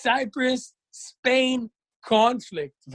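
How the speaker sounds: high, slow, dramatic vlogger intro; Nas impression. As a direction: announce it like a hyped explainer video. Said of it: "שנאס תמיד אומר כזה".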